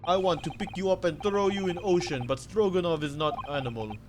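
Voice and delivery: put-on loud, boastful Russian accent; Russian sailor accent. Sailor boasting about the first class area, Russian accent. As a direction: puff up like a guard in Russian accent.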